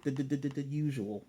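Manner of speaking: rapid repeated stutter on the first word; imitated stutter. Hiro answering what he wants to eat, put-on stutter. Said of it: "stutters when he says".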